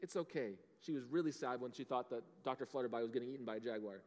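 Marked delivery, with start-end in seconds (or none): 0.0-0.6 tender dad. Retold or imitated. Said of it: "I said".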